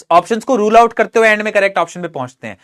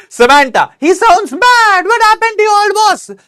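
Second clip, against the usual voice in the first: put-on much higher, slow, girlish character voice; Noori's voice; 12 semitones higher, 6 dB louder, much slower than usual. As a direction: play the worried friend, high and drawn out. Reading Noori's lines in voice. (ऊँची, धीमी लड़की की आवाज़)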